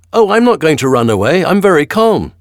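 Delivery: put-on high, loud child's voice; little boy defying the bailiff; brave boy. A narrator's reading. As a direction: answer the tyrant boldly as a boy.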